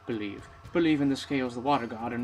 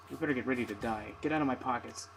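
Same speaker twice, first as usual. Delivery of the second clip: ordinary delivery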